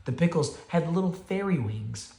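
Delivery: usual speaking voice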